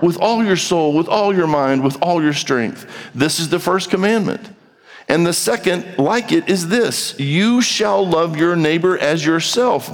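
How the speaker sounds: a lifted, measured Bible reading; solemn reading of scripture. Acted. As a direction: read it out as the Lord's own words. Reads Jesus' words from Mark.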